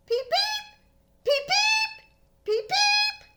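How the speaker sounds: tiny squeaky peeps, very high; high frog peeps. Acted. Storyteller voicing the frogs, high peeping. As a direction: chirp like little spring peepers. Peepers everywhere.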